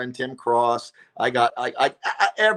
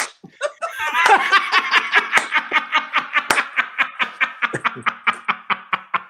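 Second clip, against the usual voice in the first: long breathy laugh; 13 semitones higher, 3 dB louder than usual. Real, not acted. Savoring the slow-clap payoff, long real laugh. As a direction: let the laugh run out.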